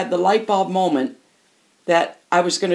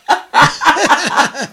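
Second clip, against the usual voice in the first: real laugh; 8 semitones higher, 5 dB louder than usual. Real, not acted. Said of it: "Which really made me laugh".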